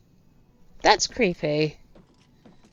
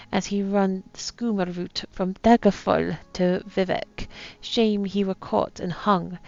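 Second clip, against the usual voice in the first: attempted pirate accent, reading aloud. Captain writes his last words, attempted pirate accent. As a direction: read it like an old pirate captain. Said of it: "my attempt at a pirate accent".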